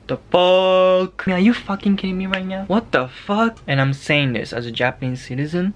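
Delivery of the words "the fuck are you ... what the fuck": high-pitched, drawn-out exasperation; theatrical disbelief. Pretend. Vlogger berating the government over masks, in theatrical disbelief. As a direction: wail it in mock outrage. Mock outrage at the prime minister.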